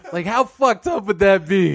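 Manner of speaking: ordinary talk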